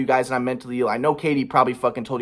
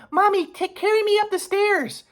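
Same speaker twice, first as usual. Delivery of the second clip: put-on very high little child voice; toddler voice; slower than usual. Father imitates toddler asking mom, high child voice. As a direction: squeak it like a small kid. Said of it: "Milo said".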